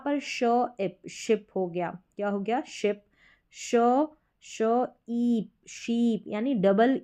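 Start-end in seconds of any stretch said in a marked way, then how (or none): 1.1-1.5 शिप with a short, quick "i". Shown as a demonstration. Teacher building the word ship for learners, short vowel shown. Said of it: "short sound रहेगा आई का". 5.1-6.2 शीप with a drawn-out "ee"; long ee. Shown as a demonstration. Teacher building sheep from its sounds, long vowel shown. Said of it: "इसका उच्चारण बड़ी ई के रूप में होगा".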